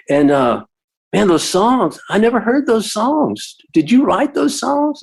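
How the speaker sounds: put-on wide-eyed kid quizzing the songwriter; curious teenager. Teen fan asks about songs.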